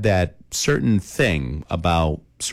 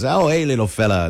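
put-on light Australian accent, tossed off; a quick hint of an Australian "crikey"; mock Aussie crikey; 5 semitones higher than usual. Snake catchers in Australia greeting the python, light Australian accent. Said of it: "they get the accents and everything".

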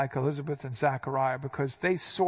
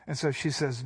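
ordinary talk, nothing marked

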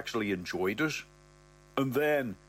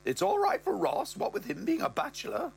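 higher mother voice, rising high at the end; mother's voice; 11 semitones higher than usual. Acted. Mother tells Brett about Ross's libido, in a higher voice. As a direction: voice the mother, pitched up and prim. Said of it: "She goes".

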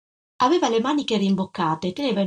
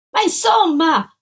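louder, higher frustrated cry; shouting; 9 semitones higher, 6 dB louder than usual. A narrator's reading. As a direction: shout it out, exasperated. (grido esasperato)

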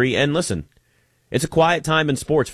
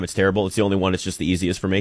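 normal talking voice

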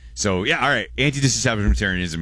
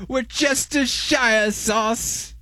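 "Worcestershire sauce" stretched very slow and much higher in British style; fancy British accent; 4 dB louder, much slower than usual. Shown as a demonstration. Host demands the British way of saying the sauce, slow and high. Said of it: "you have to say it like that".

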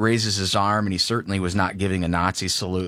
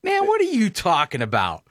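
high incredulous put-on voice, scoffing; mocking voter; 11 semitones higher than usual. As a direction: play a fed-up everyman scoffing at her. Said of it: "People look at her and go".

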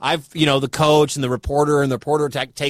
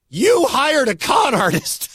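mock accusation; 9 semitones higher, slower than usual. Mocking the hostile question.

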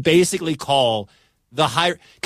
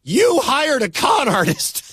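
mock accusation; 9 semitones higher, slower than usual. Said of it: "It's not a".

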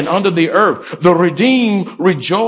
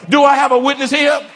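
calling out; 7 semitones higher, faster than usual. Real, not acted. Preacher calling for response.

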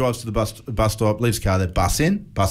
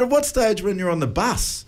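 higher-pitched mock questioning, amused; teasing incredulity; 7 semitones higher than usual. Dad quizzing son about the bus ride, teasing disbelief. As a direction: needle him with a baffled, pitched-up question.

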